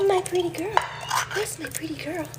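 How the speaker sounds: high-pitched cooing to a cat; cooing pet talk. Real, not acted. Owner to her pretty cat, cooing high.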